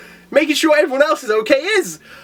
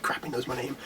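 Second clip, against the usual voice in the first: much softer, lower muttered aside; under the breath; 10 semitones lower, 16 dB quieter than usual. Retold or imitated. Saitama realizing he is caught, muttered much softer. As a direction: mumble it to yourself.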